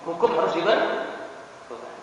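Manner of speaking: high, forceful start, settling a little; stern intonation. Retold or imitated. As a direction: lay down the law firmly. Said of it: "intonasinya begitu".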